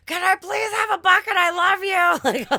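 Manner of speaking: high, loud, slow over-the-top begging. Retold or imitated. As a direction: plead like your life depends on the bucket. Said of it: "begging them".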